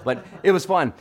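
ordinary talk

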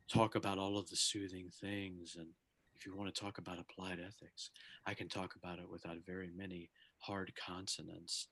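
soft, low ASMR murmur. Shown as a demonstration. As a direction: close to the mic and soothing. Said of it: "[name] was demonstrating".

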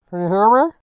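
muffled through a tin can; talking into a can; tin can phone. Acted. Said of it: "try it through the can".

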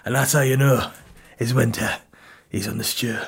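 put-on accent, deadpan fantasy character; Game of Thrones voice. Character explains Jon Snow's errand for sauce, mock Game of Thrones voice. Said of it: "And he'll be like".

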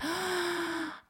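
big acted gasp of a betrayed kid; long, dramatic intake of breath; shocked gasp. Child learns mom was joking, shocked gasp.

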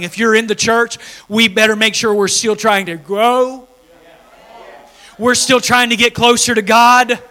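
climbing fervent sermon voice; full-force preaching. Acted. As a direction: preach it hard to the congregation.

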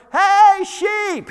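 high, loud call across the hill; hollering. Retold or imitated. Shepherd calling his distracted sheep, loud holler. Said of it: "he's hollering at him".

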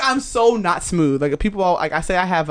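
normal talking voice

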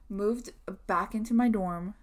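ordinary talk

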